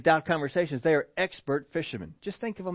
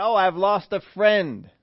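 mock grief; 5 semitones higher, 5 dB louder, slower than usual. Mock grieving Jesus, rejected reading.

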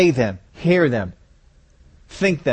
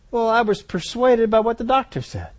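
lightly voiced believer, higher pitched; a higher-pitched excuse, mildly acted; excusing oneself; 5 semitones higher than usual. Believer answers God about the doctor, lightly acted higher excuse.

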